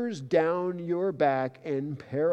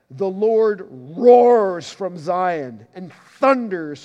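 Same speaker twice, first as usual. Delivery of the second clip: loud, slow booming proclamation; thundering scripture reading; 3 semitones higher, 10 dB louder, much slower than usual. Acted. Preacher reading the Lord's roar to the congregation, booming and slow. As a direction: boom it out like a roar from heaven.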